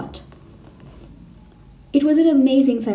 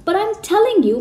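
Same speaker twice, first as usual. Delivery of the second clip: much higher, loud shout; 10 semitones higher, 3 dB louder than usual. A narrator's reading. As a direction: cry out, refusing to back down. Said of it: "Matilda shouted".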